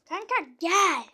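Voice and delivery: taunting yell. Real, not acted. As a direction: call him out loudly. Taunting enemy louder.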